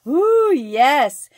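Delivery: loud, high delighted ooh yes; excited ooh. Real, not acted. Reacting to the card's words, loud and delighted. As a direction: burst out, thrilled by the words.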